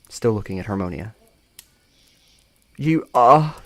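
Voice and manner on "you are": high, loud, halting mock-British; stuttering British attempt. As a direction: stammer in a badly faked British accent. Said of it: "she stutters".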